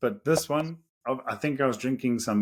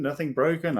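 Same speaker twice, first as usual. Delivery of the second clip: normal talking voice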